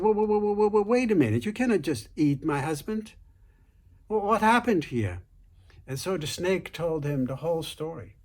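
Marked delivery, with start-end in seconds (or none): 0.0-5.2 high, loud, frantic protest; alarmed wife. Retold or imitated. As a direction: cry out to stop the snake, higher and louder. Wife stops snake eating husband.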